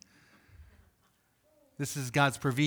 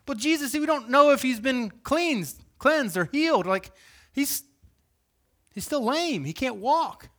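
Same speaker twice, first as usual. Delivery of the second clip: objecting crowd; 10 semitones higher, 4 dB louder than usual. Retold or imitated.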